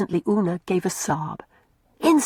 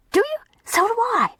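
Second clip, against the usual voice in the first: high, bright-voiced girl; eager girl voice. A narrator's reading. Mary answering Una in a high girlish voice. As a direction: pitch it up like an excited child.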